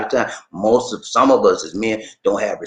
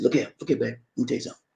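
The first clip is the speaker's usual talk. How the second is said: serious demonstration; 7 dB quieter, much faster than usual. Shown as a demonstration. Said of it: "do just like I'm doing".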